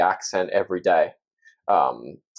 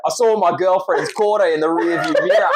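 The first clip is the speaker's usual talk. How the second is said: a brighter Australian accent, higher and a bit louder; 7 semitones higher, 3 dB louder than usual. Shown as a demonstration. Asked again, guest says the twister in a thicker Australian accent. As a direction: rattle it off in full Aussie accent. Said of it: "now do it in Australian".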